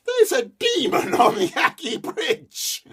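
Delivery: high, loud breathless news; excited. A narrator's reading. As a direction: blurt out the news to father. Son reports the demon.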